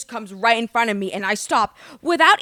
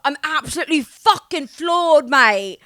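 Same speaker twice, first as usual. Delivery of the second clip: loud, raised mock British outrage; dramatic British accent; 6 semitones higher, 4 dB louder, slower than usual. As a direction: ham up a floored Brit's accent. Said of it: "people from the U K, the way they say anything".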